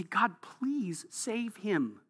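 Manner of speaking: slow, soft plea to God; soft pleading prayer. Acted. Preacher voicing a believer praying for his killer, soft slow plea. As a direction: plead quietly, slowly, for the enemy.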